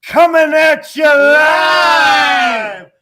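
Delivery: high, loud, stretched hype shout; ring announcer shout. Pretend. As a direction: roar the big introduction slowly. Mock ring announcer climax.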